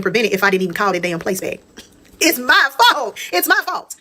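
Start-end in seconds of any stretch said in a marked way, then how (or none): 2.2-4.0 real hurt spilling over as she blames herself; voice breaking in pain. Real, not acted. Telling her story, she breaks down blaming herself, voice cracking high. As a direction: pitch leaps high and cracks, the first slow and drawn out.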